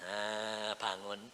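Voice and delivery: ordinary talk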